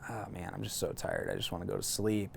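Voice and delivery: fading, tired murmur; half asleep, barely getting words out; drowsy and weak. Retold or imitated. Wounded, getting sleepy.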